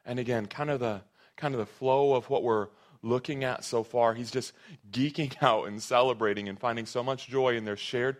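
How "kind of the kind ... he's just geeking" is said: ordinary talk, nothing marked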